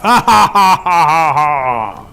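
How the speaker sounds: big, loud, acted giant's laugh; booming laugh. A narrator's reading. Flint laughs at fleeing Rabbit, booming laugh. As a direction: laugh loudly in slowing, falling bursts. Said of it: "then begins to laugh".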